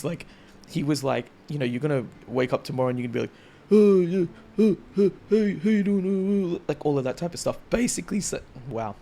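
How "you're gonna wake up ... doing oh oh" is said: high, loud croaky wrecked-voice fan. Retold or imitated. Idol mimicking fans who screamed their voices away. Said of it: "I'm doing his impersonation right now with this voice".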